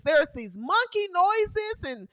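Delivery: ordinary delivery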